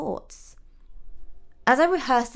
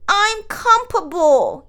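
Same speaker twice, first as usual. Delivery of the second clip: put-on high, loud, drawn-out toddler protest; slow, high little-boy voice; young dissenter; 11 semitones higher, 9 dB louder, slower than usual. A narrator's reading. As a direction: insist loudly like a small child. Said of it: "the young dissenter".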